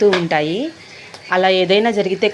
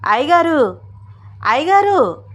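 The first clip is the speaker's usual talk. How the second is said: high, rising-and-falling call; 7 semitones higher, 3 dB louder than usual. A narrator's reading. Farmhand calls his master from the doorway, calling out. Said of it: "గుమ్మం బయట నుంచుని పిలుస్తున్నాడు".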